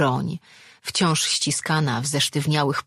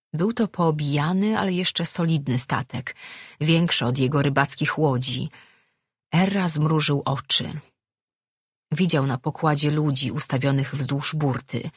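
plain reading voice

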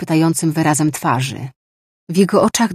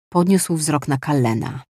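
ordinary delivery

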